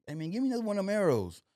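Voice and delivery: high, quick excited demand; eager. Retold or imitated. New archer begging the shop owner for arrows, eager. Retells being hooked after first shot.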